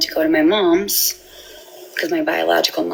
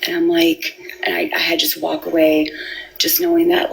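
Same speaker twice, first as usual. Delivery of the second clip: ordinary talk, nothing marked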